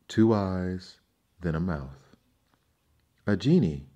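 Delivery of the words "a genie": loud frightened gasp of a word; gasped in terror. A narrator's reading. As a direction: blurt it out in sudden terror. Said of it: "gasped the fisherman".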